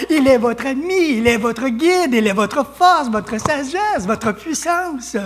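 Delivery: emphatic sermon delivery with swooping pitch; fervent preaching. Real, not acted. Preacher urging the church to rely on grace, fervent preaching. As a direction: build the list phrase by phrase for the congregation.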